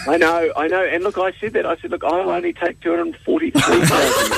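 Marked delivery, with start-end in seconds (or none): 1.9-4.4 high, laughing mock bargain; mock haggling. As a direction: play the diva lowering his fee, laughing. Mock haggling over his fee.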